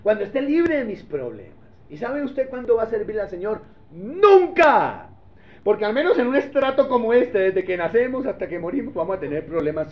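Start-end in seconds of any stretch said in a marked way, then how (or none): 3.9-5.1 one word hurled out loud; a sudden loud "never"; emphatic shout. Real, not acted. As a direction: slam the answer down hard. Preacher answers his own question.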